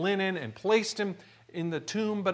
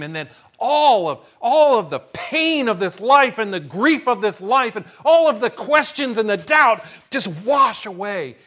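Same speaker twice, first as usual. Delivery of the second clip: preaching climax; 3 semitones higher, 8 dB louder than usual. Real, not acted.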